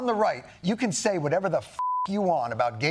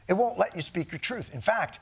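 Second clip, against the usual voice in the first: usual speaking voice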